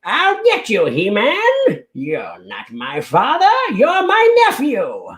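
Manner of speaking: high, wobbly cartoon villain rant; Skeletor voice. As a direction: vow revenge like a foiled villain. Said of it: "Do your best Skeletor voice".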